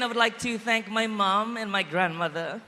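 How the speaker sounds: ordinary talk, nothing marked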